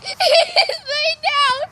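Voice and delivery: slow and high through real laughter; drawn-out giggling words; laughing. Real, not acted. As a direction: laugh helplessly at the dog. Laughing at dog.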